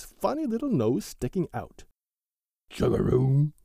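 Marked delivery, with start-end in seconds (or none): 2.7-3.5 louder, rumbling frog call; frog croak. A narrator's reading. Frog's croak.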